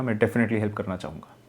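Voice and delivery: ordinary talk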